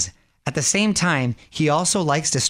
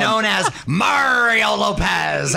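pirate voice; 7 semitones higher, slower than usual. Acted. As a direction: ham up a pirate, crying it out. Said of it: "International Talk Like a Pirate Day".

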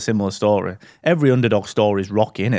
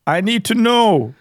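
high, hammed-up British boxer; Rocky; 6 semitones higher than usual. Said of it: "if Rocky was, like, in England".

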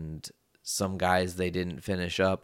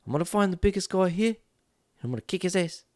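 much higher mock voice of a brash kid, fast; cocky kid; 12 semitones higher than usual. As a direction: puff up like a kid picking a prison fight. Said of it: "And Russell's like".

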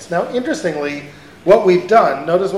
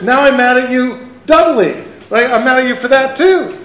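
louder, higher mock indignation as God; rising mock outrage, the wronged God; God getting angry; 6 semitones higher, 4 dB louder than usual. God rebukes misquoting worshippers, hammed-up angry. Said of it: "what's to stop God from saying".

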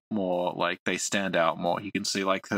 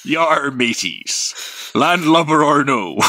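slow, louder hammy pirate growl; 5 semitones higher, 7 dB louder, slower than usual. Pretend. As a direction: ham it up like an old sea pirate. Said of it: "your best pirate voice".

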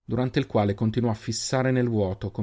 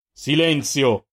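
sharp raised-voice command, higher and louder; angry yell; 4 semitones higher, 5 dB louder, much slower than usual. A narrator's reading. Giofix cuts off the others' talk, angry yell. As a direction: shout them down. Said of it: "le urlò Giofix".

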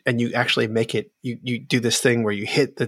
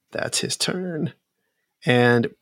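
normal talking voice

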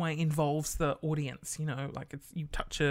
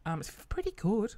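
usual speaking voice

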